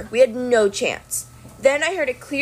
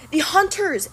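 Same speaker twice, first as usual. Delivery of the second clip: cried out; 7 semitones higher than usual. A narrator's reading. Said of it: "Annabeth cried".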